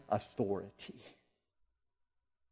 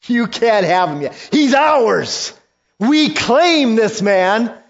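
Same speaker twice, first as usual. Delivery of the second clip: high, loud, possessive shout; loud demon claim; 9 semitones higher, 6 dB louder than usual. Acted. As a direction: demons insisting, raised and loud. Demons arguing, rising.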